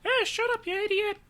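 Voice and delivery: very high, loud cartoon dog; dad's dog voice. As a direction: imitate dad squawking as the dog. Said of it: "has given my dogs voices".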